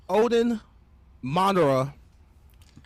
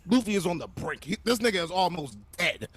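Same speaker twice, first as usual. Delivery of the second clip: normal talking voice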